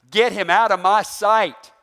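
loud, slow, angry order; commanding Pharaoh. Acted. Pharaoh ordering his men to remove Abram, loudly angry.

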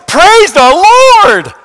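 high, drawn-out shout of praise; loud voice. Acted. Healed man glorifies God, in a loud voice. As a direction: belt it out, holding nothing back. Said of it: "With a loud voice".

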